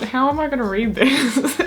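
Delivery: high, helpless laughing speech; giggling. Real, not acted. Reader despairing of finishing the confession, giggling. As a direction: squeal it through laughter. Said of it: "if we keep giggling".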